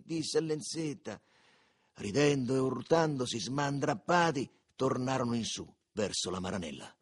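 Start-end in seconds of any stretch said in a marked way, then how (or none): none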